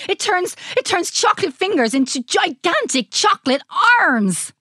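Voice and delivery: high, stammering, grand boast; excitable mad scientist. A narrator's reading. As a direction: build up to a big finish. Scientist boasting of his invention.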